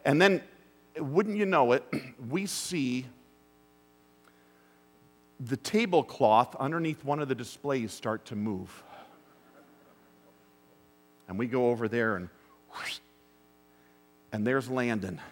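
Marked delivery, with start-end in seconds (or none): none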